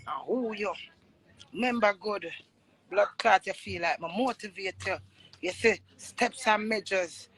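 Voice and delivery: usual speaking voice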